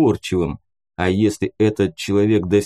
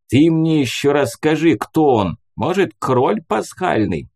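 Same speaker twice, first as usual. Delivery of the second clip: mocking; 6 semitones higher than usual. A narrator's reading. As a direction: sneer at the question. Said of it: "сказал он с издёвкой".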